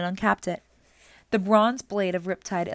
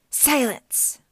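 higher, snarling order; snarled command; 6 semitones higher, 3 dB louder than usual. A narrator's reading. Medusa cuts off Annabeth's warning, snarling. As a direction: snarl it to shut her up. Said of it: "Medusa snarled".

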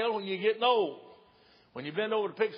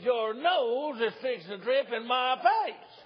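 drawn-out mock warning; 6 semitones higher, slower than usual. Repeated warning to the nurse.

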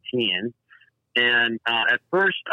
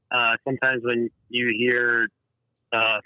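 usual speaking voice

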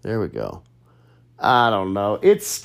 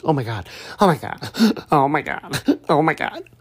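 higher, breath-snatching mock fangirl freak-out; hyperventilating fangirl; 7 semitones higher than usual. Girl recognizing him in Coyote Ugly, hyperventilating fangirling. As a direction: imitate a starstruck fan gasping between exclamations. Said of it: "like, the hyperventilation thing".

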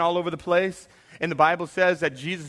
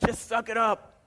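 mock dismissiveness; 4 semitones higher, 4 dB quieter than usual. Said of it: "and I'm like".

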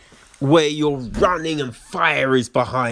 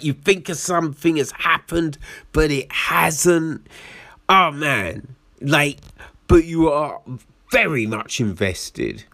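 usual speaking voice

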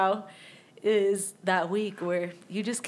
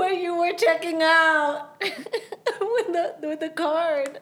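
laughing; 9 semitones higher, 5 dB louder, slower than usual. Real, not acted. Laughing through the telling.

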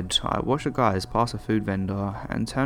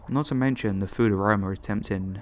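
ordinary delivery

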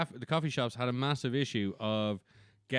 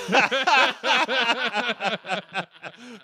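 high loud laugh; 8 semitones higher, 6 dB louder than usual. Real, not acted. Laughing after the accent bit.

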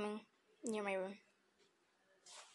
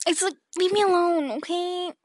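louder, higher fed-up burst; exasperated outburst; 7 semitones higher, 6 dB louder than usual. Real, not acted. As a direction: snap it out, done with everyone. Exasperated about recording.

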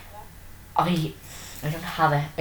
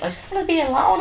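mock moping; 13 semitones higher, faster than usual. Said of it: "Sam was just like".

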